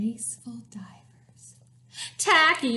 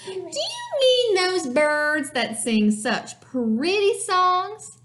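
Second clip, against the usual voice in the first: hammed-up Tacky; 6 semitones higher than usual. A narrator's reading. Reader as Tacky asking the hunters about singers, hammed-up question. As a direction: stretch it out with big mock wonder.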